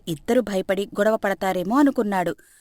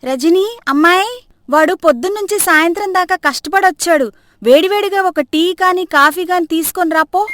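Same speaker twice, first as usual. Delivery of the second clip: higher, louder sweet fussing call; fussing mother-in-law; 7 semitones higher, 7 dB louder than usual. Acted. Mother-in-law calls daughter-in-law for son's tea, higher and louder. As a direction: put on a show of caring for the son. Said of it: "plan ప్రకారం action".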